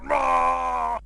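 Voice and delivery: hammed-up angry shout; mock yell. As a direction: belt it out in a fake rage. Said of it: "yells even more".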